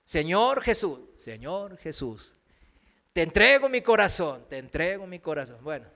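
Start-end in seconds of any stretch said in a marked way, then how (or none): 1.2-2.3 Señor Jesús echoed much softer and lower, slow; a new convert mumbling Señor Jesús back; half-hearted mumbled echo; mumbled repeat. Retold or imitated. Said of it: "di con todo tu corazón". 4.5-5.6 te entrego mi corazón echoed quickly, much softer and lower; mock insincere echo; rushed mumbled repeat. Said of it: "di con todo tu corazón".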